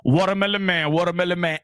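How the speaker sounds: a hint of a vendor calling from a van; imitating street vendor. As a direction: imitate the watermelon van's loudspeaker call. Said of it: "he used to have the loudspeaker on".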